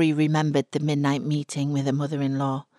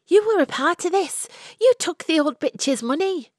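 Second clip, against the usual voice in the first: Florrie's accusation; 12 semitones higher, 4 dB louder than usual. A narrator's reading.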